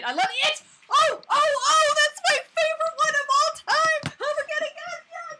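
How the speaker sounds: high, loud fangirl squeal; squealing at full volume; excited outburst. Real, not acted. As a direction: shriek it with uncontained excitement. Freaking out over favorite film.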